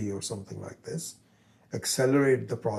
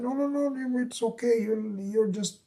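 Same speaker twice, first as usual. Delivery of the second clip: much higher, hurried reassurance from the friends; friends soothing; 12 semitones higher than usual. Retold or imitated. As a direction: coax him quickly, voice pitched up high. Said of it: "and so people said".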